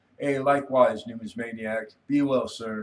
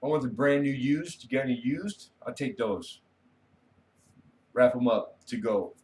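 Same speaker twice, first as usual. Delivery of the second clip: cheeky customer; 3 semitones higher than usual. Retold or imitated. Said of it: "I go".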